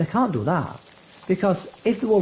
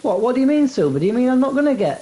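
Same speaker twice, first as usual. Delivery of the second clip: mock surprise; 7 semitones higher, faster than usual. Mock surprise at broken promise.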